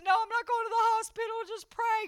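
high crybaby whine; crying. Retold or imitated. Refusing the hospital while in pain, crying. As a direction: wail in stubborn protest. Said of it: "I was crying".

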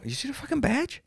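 high, offended authority snap; squeaky petty-authority demand; indignant official. Retold or imitated. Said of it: "I go".

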